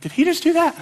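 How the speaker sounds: high-pitched astonished question, acted out; stunned disbelief. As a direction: play a dumbfounded onlooker. Stunned disciples looking at each other.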